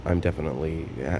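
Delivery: ordinary talk, nothing marked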